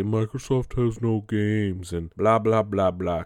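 drawn-out, hammed-up fanboy whine; mock whining. Gamer mocking fanboys who complain about Microsoft, mock whining. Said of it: "cry and whine and say".

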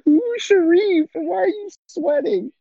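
put-on squeaky high confused girlfriend; high-pitched girlfriend voice. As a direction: pitch way up, baffled. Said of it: "She's just like".

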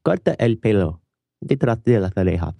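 Spanish lisped the Castilian way; Castilian Spanish accent. Shown as a demonstration. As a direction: say "Corta el pelo" with a Castilian lisp. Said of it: "would you like to hear it with a Castilian accent".

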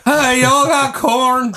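hammed-up country neighbor. Joking that the neighbor drops in, hammed-up slow yokel call.

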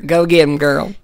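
loud, playful Southern cheer; hammed-up Southern encouragement; Southern accent. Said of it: "Southern being".

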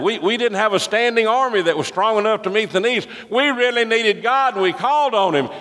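fiery preacher rising with conviction; preaching at full force. Real, not acted. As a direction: preach it up to a climax.

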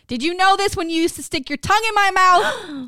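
high, loud, incredulous demand; mock outrage. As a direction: blurt it out in comic disbelief, voice climbing. Mock outraged retort to friend's text.

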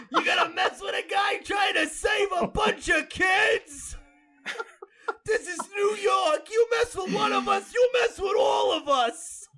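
hammed-up high Brooklyn shouting; Brooklyn guy. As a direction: yell it like an outraged New Yorker. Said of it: "the Brooklyn guy?".

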